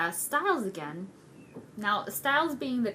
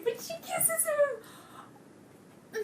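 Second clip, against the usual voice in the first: very high-pitched, overcome squeal; uncontained fangirl squeal; 4 dB quieter than usual. Real, not acted. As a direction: lose control and squeal with excitement. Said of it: "I've contained myself now".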